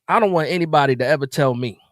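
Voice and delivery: ordinary delivery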